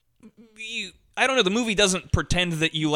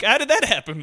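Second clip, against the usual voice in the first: mock baffled; 7 semitones higher, 3 dB louder than usual. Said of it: "I'd be like".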